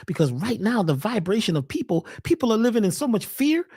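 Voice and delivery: ordinary talk, nothing marked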